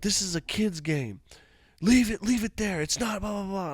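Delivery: high-pitched mock protest; imitated indignant wife. Wife protesting it's a kid's game, imitated indignation. Retold wife arguing back.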